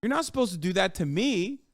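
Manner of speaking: whiny, incredulous protest; hammed-up indignation, rising in pitch at the end; mock outrage. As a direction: imitate an aggrieved complainer pleading unfairness. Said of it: "losing their shit when they were like".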